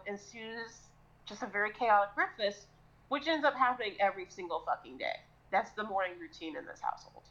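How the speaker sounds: normal talking voice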